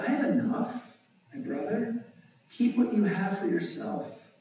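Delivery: lightly acted brother, pitched high; Esau declining. A narrator's reading. Voices Esau refusing the gift.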